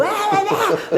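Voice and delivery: very high, drawn-out comic yell; hammed-up wordless yelling with a laugh; mock yelling. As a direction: imitate shouting gibberish at a very high pitch. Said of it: "falar em inglês gritando".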